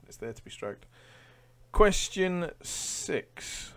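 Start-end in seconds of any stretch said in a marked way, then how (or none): 1.7-3.7 slow, drawn-out, louder higher announcement; quiz announcement. Acted. Quizmaster announcing the next round to viewers, drawn out. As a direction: stretch out the question number like a game show.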